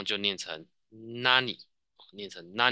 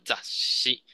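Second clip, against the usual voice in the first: whispered drill; 2 semitones higher than usual. Shown as a demonstration. Teacher drills the word.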